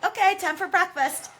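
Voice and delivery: high, chipper and offhand; breezy Tyrion. Retold or imitated. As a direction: toss it off cheerfully. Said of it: "Tyrion's then just like".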